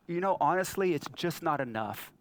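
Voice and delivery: usual speaking voice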